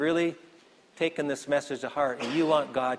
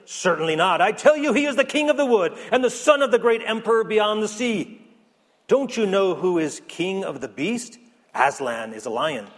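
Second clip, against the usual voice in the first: high, loud, insistent storybook beaver; stern beaver; 4 semitones higher, 5 dB louder than usual. Acted. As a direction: proclaim it proudly as the beaver, much higher. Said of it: "said Beaver sternly".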